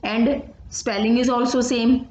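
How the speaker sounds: usual speaking voice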